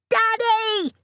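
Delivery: put-on very high little boy's yell; child's yell. A narrator's reading. Son running to greet his father, excited yell. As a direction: yell like an eager small boy. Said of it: "Oscar yelled again".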